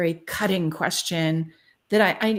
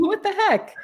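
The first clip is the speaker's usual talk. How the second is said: mock outrage; 11 semitones higher than usual. Real, not acted. Joking exclamation.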